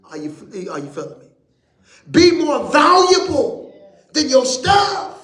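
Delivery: high, loud preacher's call; full-force preaching. Acted. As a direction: preach it up to the rafters.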